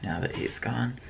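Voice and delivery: hushed whisper. Real, not acted. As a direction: whisper so the cat won't hear. Whispers after cat leaves.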